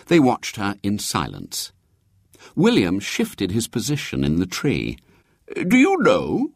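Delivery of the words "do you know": higher, louder confiding question; bishop voice. A narrator's reading. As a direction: lean in knowingly. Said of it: "said the bishop".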